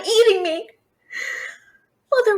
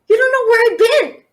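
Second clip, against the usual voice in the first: higher, louder, coy insinuation; tipsy tavern boast; sly brag; 6 semitones higher, 5 dB louder than usual. Acted. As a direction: wink it at the dragon. Bragging to dragon, voice shifts.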